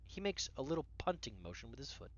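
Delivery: ordinary delivery, nothing marked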